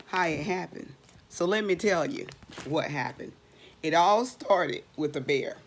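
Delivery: ordinary delivery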